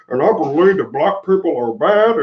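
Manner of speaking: a mild, hammed-up politician voice for laughs; Mitch McConnell impression. Host jokes to livestream viewers with a light mock McConnell voice. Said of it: "my Mitch McConnell imitation".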